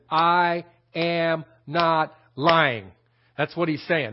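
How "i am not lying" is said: very slow, word by word; slow, emphatic reading. Shown as a demonstration. Preacher showing how Paul's phrase should be read, very slowly. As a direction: separate every word with weighty, drawn-out emphasis. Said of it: "the phrase should be read slowly".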